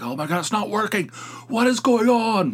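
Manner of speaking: high, fast, panicky rising to a yelp; mock freak-out. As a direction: play your old panicking self, voice climbing and rushed. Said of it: "I used to freak out".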